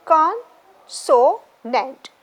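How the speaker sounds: "consonant" drawn out as con, so, nant; very slow "consonant", a gap at each hyphen; three separate syllables. Shown as a demonstration. Teacher showing where hyphens go, syllable by syllable. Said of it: "you have to put hyphen three times".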